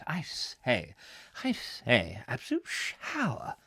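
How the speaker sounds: theatrical mock outrage; put-on catchphrase voice. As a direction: send it up as a mock-indignant comic turn. Put-on catchphrase in reply to message.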